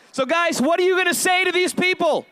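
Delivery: ordinary delivery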